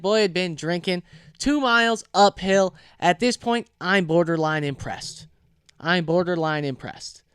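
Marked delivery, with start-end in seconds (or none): none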